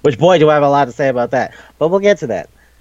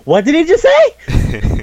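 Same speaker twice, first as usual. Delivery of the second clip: shocked squeak, much higher and slower; freaked-out disbelief; 4 dB louder, slower than usual. Retold or imitated. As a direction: gape at it in high-pitched horror. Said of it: "Sukune just freaks the fuck out".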